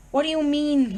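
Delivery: ordinary delivery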